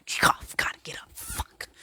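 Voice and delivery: whispered grumbling, then a muttered curse; mock getting out of bed annoyed, under the breath; huffy whisper. Dragging yourself out of bed at night, a huffy whisper. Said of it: "Try not to get up real huffy".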